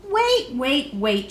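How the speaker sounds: shouting. A narrator's reading. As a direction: stop them, it's a mistake. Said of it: "shouted Faith".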